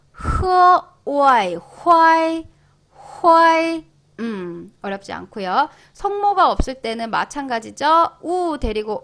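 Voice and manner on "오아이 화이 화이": 오아이 then 화이 joined on a high level tone; loud, held 화이 built from 오아이; syllable drill. Shown as a demonstration. Teacher blending initial and final, syllable drill.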